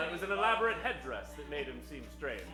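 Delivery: normal talking voice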